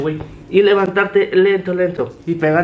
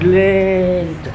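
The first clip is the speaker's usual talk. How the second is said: slow; 3 dB louder than usual. Shown as a demonstration. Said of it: "lento, güey".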